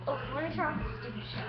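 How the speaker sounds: quick, soft aside; fast aside. Real, not acted. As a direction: rush through it under your breath. Aside while turning off TV.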